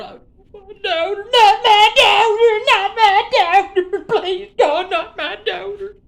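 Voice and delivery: high, loud hammed-up wail, slow and stretched; fake-sob the plea like a soap opera; mock wailing. Vlogger mock-wailing about his daughter being exposed.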